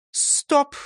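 high sharp stop; command. Acted. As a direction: halt him. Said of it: "скомандовала Фрёкинсталь". (командно)

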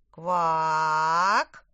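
long loud frog croak; deep croak. Acted. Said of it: "опять квакнул король лягушонок".

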